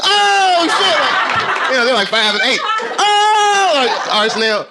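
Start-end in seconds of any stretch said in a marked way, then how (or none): 0.0-1.0 mimicking kids screaming, very high and loud; hammed-up high-pitched yell of shock; shocked kids' shriek. His kids' shocked reaction in car. 2.6-3.7 mimicking kids screaming, very high and loud; hammed-up high-pitched yell of shock; shocked kids' shriek.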